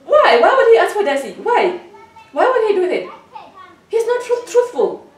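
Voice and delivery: real outrage, firing the questions at him; outraged. Real, not acted. Mother furious that the husband asks for Darcy, outraged.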